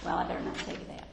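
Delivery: fast, hushed whisper to herself; whispered aside. Real, not acted. Preacher stops herself before telling a story, whispered. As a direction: whisper it quickly as if catching yourself.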